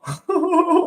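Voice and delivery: sudden high burst of laughter; delighted squeal of a laugh. Real, not acted. Streamer laughing at a stunning goal, high laugh.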